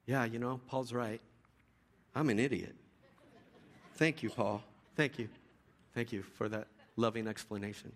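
low, subdued sarcastic gratitude; mock contrition. A scolded listener thanks Paul, mock-humble and subdued. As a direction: meekly pretend to accept the scolding. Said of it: "how many people at the end of that conversation are gonna say".

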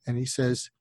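usual speaking voice

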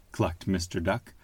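ordinary delivery, nothing marked

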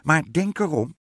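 high, loud witch warning; piercing storybook witch call; witch calling after. A narrator's reading. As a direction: shout a warning after someone leaving. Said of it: "riep de heks haar achterna".

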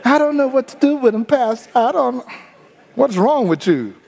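high, fast mock wife complaining; hammed-up wife, fast and high; exasperated wife. Wife voiced complaining about husband.

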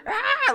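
very high mock scream; imitated scream. Preacher imitating screaming creatures in the film, mock scream. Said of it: "like, screaming".

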